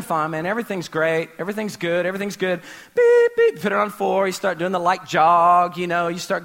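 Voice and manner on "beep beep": high electronic button beeps; imitated beeps. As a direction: beep like treadmill buttons being pressed. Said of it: "and you go".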